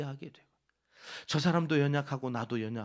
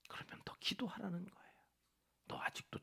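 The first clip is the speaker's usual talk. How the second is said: whisper; 3 semitones higher, 10 dB quieter than usual. Acted. Preacher drops to whisper.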